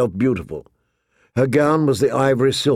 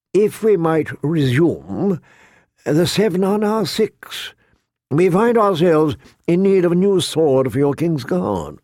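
higher, measured courtly voice; higher-pitched, deliberate counsellor; courtier addressing council; 5 semitones higher than usual. A narrator's reading. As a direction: present business to the king with formal care. Littlefinger presents council business to king.

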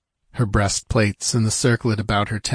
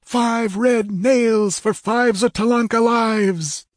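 high, slow triumphant cry; high-pitched vengeful exultation; exulting; 13 semitones higher, slower than usual. Acted. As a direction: draw out each word in savage triumph. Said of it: "exulted Techotlь".

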